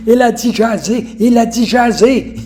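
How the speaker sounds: thrilled and amused, echoing the funny word; amazed barber. Retold or imitated. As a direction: repeat it like a barber who can't believe his ears. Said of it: "il en revenait pas".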